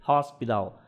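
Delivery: "Hospital" with the t softened toward d. Shown as a demonstration. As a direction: say "Hospital", t nearly a d. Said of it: "mais pra perto do D".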